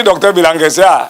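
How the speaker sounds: ordinary talk, nothing marked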